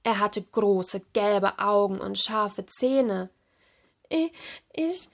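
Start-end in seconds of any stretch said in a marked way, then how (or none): 4.1-5.1 high, halting stutter; stammering. A narrator's reading. Serena facing the creepy lanternfish, stammering. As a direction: stumble over the first word, pitched up. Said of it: "stotterte Serena".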